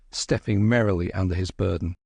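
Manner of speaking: plain reading voice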